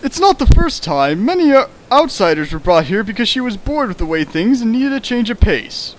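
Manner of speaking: a hint of a girlish Reimu; lightly voiced Reimu. Rereads Reimu's line in character.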